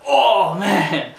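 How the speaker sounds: high, loud drawn-out exclamation; retold shocked reaction. Fan seeing a head smack the table, loud shocked cry. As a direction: wince out loud at a brutal landing. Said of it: "I was like".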